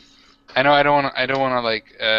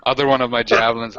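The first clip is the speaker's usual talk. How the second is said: ordinary talk, nothing marked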